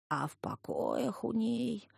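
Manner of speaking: put-on slow, high eerie old man's wail; wailing storyteller. A narrator's reading. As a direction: draw it out like a spooky campfire tale. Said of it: "проникновенным, завывающим голосом вещал дедок". (проникновенный завывающий голос деда)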